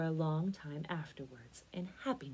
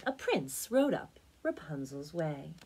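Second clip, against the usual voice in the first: usual speaking voice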